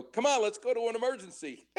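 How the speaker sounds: high-pitched, overeager rookie urging the crew on; eager plea. Retold or imitated. Student in back of ambulance to drivers, overeager. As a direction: play up a keen student itching for action.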